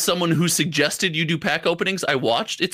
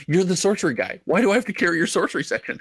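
ordinary delivery

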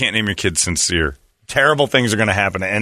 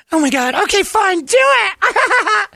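very high falsetto rising into a laughing shout; hammed-up girl squealing yes; mock girly giggle; 4 dB louder, much slower than usual.

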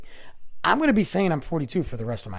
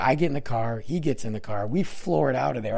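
ordinary delivery

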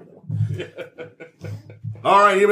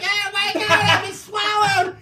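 put-on swallowed children crying out; 11 semitones higher than usual. Vomited children lament their fate, high mock wail. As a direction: squeal it like a hammed-up horrified kid. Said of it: "their faces are still animated, like".

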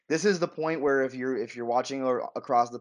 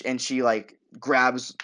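ordinary delivery

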